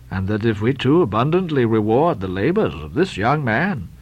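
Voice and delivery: deep, measured pious lecture; solemn. Acted. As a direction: admonish your partner gravely. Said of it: "turning solemnly toward him".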